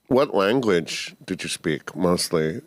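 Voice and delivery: ordinary delivery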